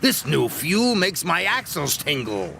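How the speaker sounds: excited engine. Acted. Diesel thrilled by new fuel.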